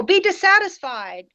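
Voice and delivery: loud mock rallying cry; a hammed-up protest call. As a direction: shout it like a slogan. Voicing media message to women.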